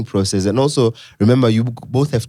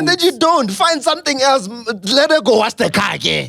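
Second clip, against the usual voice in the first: much higher, half-laughing rant; real exasperated shout; 12 semitones higher than usual. Real, not acted. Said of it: "Why are you shouting at us?".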